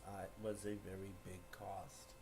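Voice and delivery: low, very soft reply; hushed, deep and grave; retold solemn answer. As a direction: imitate a villain quietly admitting his loss. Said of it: "And he goes".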